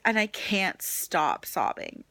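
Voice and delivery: ordinary talk, nothing marked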